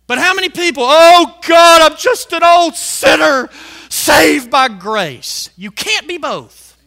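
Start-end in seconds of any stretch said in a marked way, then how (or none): 0.9-5.5 loud, drawn-out mock lament; mock sinner's plea. Preacher imitating people calling themselves old sinners, mock pleading. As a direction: imitate a self-abasing believer crying out. Mimics people's self-abasing prayer.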